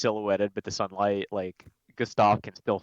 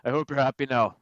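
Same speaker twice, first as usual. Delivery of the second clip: louder, higher gruff Irish voice; pointed, gruff Irish delivery; gruff Irish accent; 4 semitones higher, 7 dB louder than usual. Acted. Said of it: "a more gruff Irish accent".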